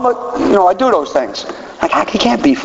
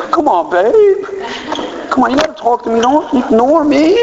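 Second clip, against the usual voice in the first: higher, whiny begging to his wife; mock pleading; 7 semitones higher than usual. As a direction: coax her to talk, hammed-up.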